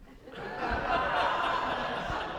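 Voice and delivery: airy laugh way up high; high breathy laugh. Real, not acted. Laughs at the ending.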